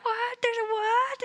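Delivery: high, startled "there's a what"; startled disbelief. Retold or imitated. Party hearing about the troll, high and startled. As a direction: squeak it out in disbelief.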